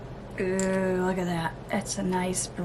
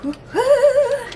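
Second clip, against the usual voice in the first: high, loud woo-hoo; squealing cheer; excited whoop; 7 dB louder than usual. Real, not acted. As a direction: cheer like a fan. Cheers last shade.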